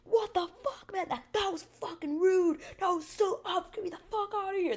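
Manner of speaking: very high, whiny indignation; hammed-up, high-pitched complaining; mock outrage. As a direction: imitate furious guys in a squeal. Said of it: "They were so mad".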